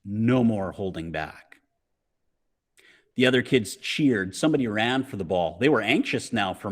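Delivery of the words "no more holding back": usual speaking voice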